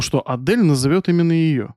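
usual speaking voice

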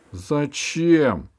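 loud, tearful howl; whiny wail. A narrator's reading. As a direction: wail it like a crybaby. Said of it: "плаксиво взвыл".

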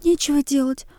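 put-on high girlish babble; babbling. A narrator's reading. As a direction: prattle it lightly. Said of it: "лепетала Оксана".

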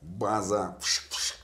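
radio call with static noises; mock walkie-talkie call, hissing static; over walkie talkie.

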